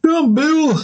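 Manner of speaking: a rough try at a high put-on Bill voice; half-hearted high Bill impression; attempted Bill voice. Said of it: "I don't know if I can do Bill's voice".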